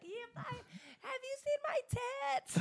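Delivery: squealing high, slow and breathy; high drunk girl voice. Retold or imitated. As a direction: shriek it like a tipsy partygoer. Drunk self at bar.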